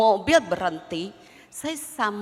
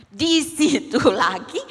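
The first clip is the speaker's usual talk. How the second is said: laughing exasperation; 4 semitones higher, 8 dB louder, slower than usual. Acted.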